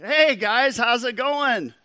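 high, loud, over-eager greeting. Retold or imitated. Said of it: "bigger than life".

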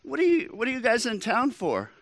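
high-pitched, nosy question. Retold or imitated. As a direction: imitate the waiter innocently asking diners their business. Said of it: "bigger than life".

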